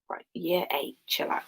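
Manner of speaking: hushed aside to the class; teacher hushing year eight; whispered aside. Real, not acted. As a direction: whisper a quick telling-off.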